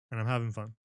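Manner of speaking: plain reading voice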